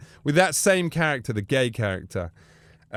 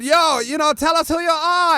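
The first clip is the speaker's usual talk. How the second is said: Bruno voice; 13 semitones higher, 4 dB louder than usual. Retold or imitated. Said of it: "I said".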